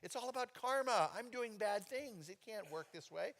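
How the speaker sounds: high, pleading protest; protesting to the Lord. Retold or imitated. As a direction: push back, flustered. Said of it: "it's like".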